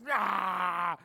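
long, loud "aah" of frustration; exasperated yell. Acted. Preacher acting out watching the news, exasperated yell. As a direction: let out a burning, fed-up yell. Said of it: "I'm just like".

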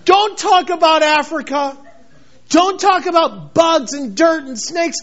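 high, loud mocking voice of a scared believer; preacher mocking a timid believer, high and loud; mock fearful believer. Mocking fearful Christians' attitude.

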